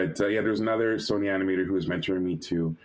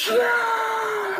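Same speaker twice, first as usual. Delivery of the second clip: very high, loud screamed cheer; long, high-pitched shriek of joy; joyful scream; 5 dB louder than usual. Retold or imitated. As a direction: scream it out in triumph. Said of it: "I'm screaming".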